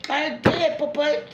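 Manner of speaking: high, loud celebratory shout; drawn-out hip-hip-hooray, high-pitched glee; excited cheering. Real, not acted. Cheering own success to the pets.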